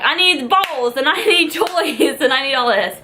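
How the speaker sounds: high, eager puppy-shopping voice; gushing over everything she needs; excited shopping list. Retold or imitated. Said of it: "I'm like".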